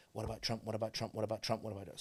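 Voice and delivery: low, quiet, repetitive chant; mock hounding. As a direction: rattle it off under your breath, over and over. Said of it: "hounding me with".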